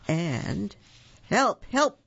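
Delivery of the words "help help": loud, high bear's cry for help; bellowing. A narrator's reading. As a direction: bellow it out in mock panic. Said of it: "bellowed Bear".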